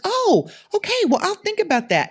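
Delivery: high-pitched mock-polite brush-off; snooty. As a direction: imitate a snooty customer dismissing a pitch. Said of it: "she was real snooty looking".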